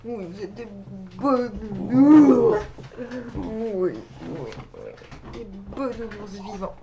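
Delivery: slow, sweet doggy talk; talking to dogs. Real, not acted. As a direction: coo at the pets. Baby talk to her dogs.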